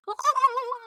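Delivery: high-pitched comic bawling; mock wail. Wordless imitation of Bethany wailing.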